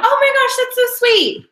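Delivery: acted squeal of delight; delighted client. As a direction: gush with pitch way up. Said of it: "she's gonna say something like".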